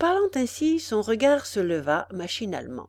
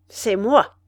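loud, looming menace; a louder, menacing reveal; terrible menace; 7 dB louder than usual. Acted. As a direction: announce yourself to terrify him. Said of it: "d'un air terrible".

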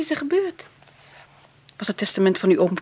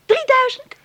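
high, louder disbelieving guess; amazed guess; 6 dB louder than usual. Acted. Hilde guessing the sum in high amazement. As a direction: gasp out the number.

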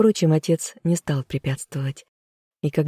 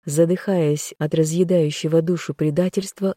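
ordinary delivery, nothing marked

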